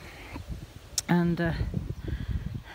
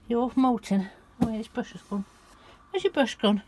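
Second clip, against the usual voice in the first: ordinary talk, nothing marked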